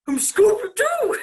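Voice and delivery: high, drawn-out cartoon dog shout; loud playful cartoon-dog impression; Scooby-Doo. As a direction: belt out a slow, high cartoon catchphrase. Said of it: "I'll try and do Scooby-Doo".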